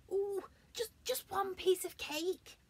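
high, loud longing plea; pleading cry. Acted. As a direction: beg for cake. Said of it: "he cried".

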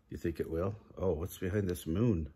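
plain reading voice

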